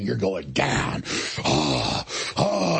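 slow, low mock hiss of a sore loser; imitated hissing. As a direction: drag it out like a sore loser's threat. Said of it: "like, hissing".

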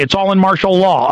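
ordinary talk, nothing marked

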